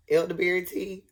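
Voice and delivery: ordinary delivery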